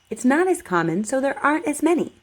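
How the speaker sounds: normal talking voice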